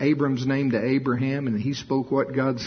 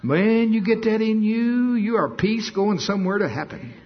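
high, loud fervent preaching; 9 semitones higher, 3 dB louder than usual. Acted. Preacher rousing the church with high loud preaching. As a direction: preach it with fire.

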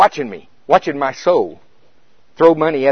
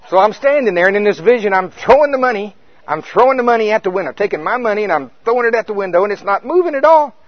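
louder, higher, building excitement; throwing money at heaven's window, getting more worked up; excited retelling; 6 semitones higher, 6 dB louder than usual. Retold or imitated. Preacher reliving his frantic money throwing, louder and higher.

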